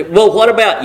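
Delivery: ordinary delivery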